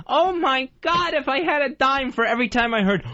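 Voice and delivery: ordinary talk, nothing marked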